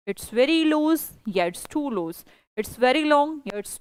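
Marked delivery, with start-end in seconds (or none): 0.1-1.1 slow model sentence. Shown as a demonstration. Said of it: "teacher demos very versus too". 1.3-2.3 slow model sentence. Shown as a demonstration. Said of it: "teacher demos very versus too".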